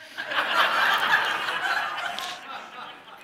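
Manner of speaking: high breathy burst of laughter. Real, not acted. Laughs at undercover agents.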